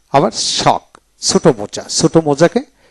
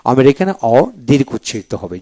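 ordinary talk

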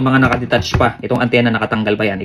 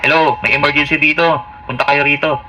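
over walkie talkie; 2 semitones higher than usual. Shown as a demonstration. Demo emergency call over radio.

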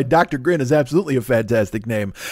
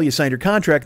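ordinary talk

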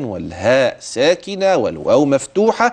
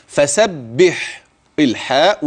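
ordinary delivery

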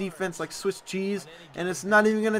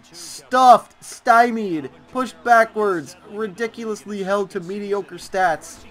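mounting rant; 5 semitones higher, 7 dB louder, slower than usual. Real, not acted. Ranting about Ezekiel Elliott's stats.

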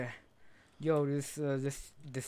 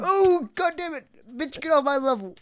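high furious yell; angry squeal; 12 semitones higher, 11 dB louder than usual. Real, not acted.

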